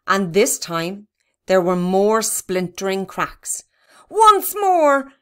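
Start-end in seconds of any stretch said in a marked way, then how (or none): 4.1-5.1 high, loud rallying shout; loud, high call to action. A narrator's reading. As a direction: urge a partner into another heave. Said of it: "shouted Barney".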